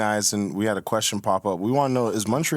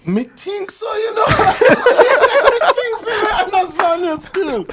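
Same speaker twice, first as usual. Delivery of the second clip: high-pitched, slow mock Jamaican patois; hammed-up Jamaican falsetto; mock Jamaican accent; 6 dB louder, much slower than usual. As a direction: squeal it in playful Jamaican, laughing. Mimics the AI's Jamaican patois, laughing.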